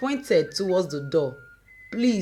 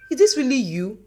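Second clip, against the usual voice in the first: high, louder disbelieving rise; shocked question; 5 semitones higher, 5 dB louder, faster than usual. Acted. Daughter shocked by her mother's behaviour, rising high. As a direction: demand it in disbelief.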